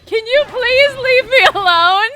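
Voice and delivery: high, loud, exasperated yelling; frustrated yell. Real, not acted. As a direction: shout at the game in frustration. Yelling at the game.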